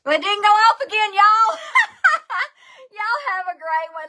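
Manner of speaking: high, loud laughing disbelief; laughing surprise. Real, not acted. Host finds the stream still running after goodbye, high laughing. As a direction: laugh it off when the stream won't stop.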